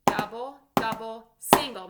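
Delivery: loud drum call with the accent on the last word; loud called sticking. Shown as a demonstration. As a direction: shout each stroke, with the last word hit hardest. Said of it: "Make sure to get the accent".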